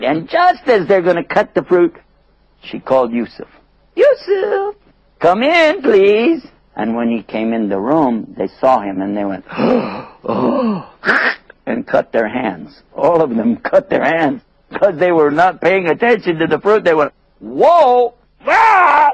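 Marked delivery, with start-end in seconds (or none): 3.9-6.5 very high hostess call; high-pitched singing-out summons. Acted. Hostess calls Yusuf into room. 9.5-10.8 high, breath-caught gasp of amazement; acted swooning gasp; awed gasps. Women see the handsome man. 17.4-19.1 high, loud whoa and scream; acted high-pitched shriek of alarm; startled cries. Women startled, cutting their hands.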